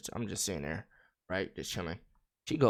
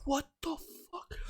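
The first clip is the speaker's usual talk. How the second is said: softer, higher baffled aside; stunned disbelief; 8 dB quieter than usual. Retold or imitated. Man reacts to screaming caller, hushed disbelief. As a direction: let it out quietly, stunned.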